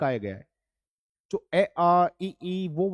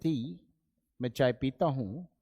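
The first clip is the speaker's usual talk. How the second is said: slow word by word sentence, softer at the end; slow dictation; 4 semitones lower, 3 dB quieter, much slower than usual. Shown as a demonstration. Teacher dictating the second example sentence. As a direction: dictate slowly, each word apart. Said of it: "इसी sentence को फिर से लेके आते हैं".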